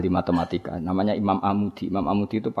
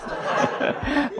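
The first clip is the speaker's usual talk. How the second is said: a high, breathy unvoiced wheeze of laughter; wheezing laugh. Real, not acted. Preacher laughs at his own joke, wheezing laugh.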